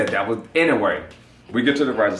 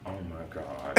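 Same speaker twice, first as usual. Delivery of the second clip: normal talking voice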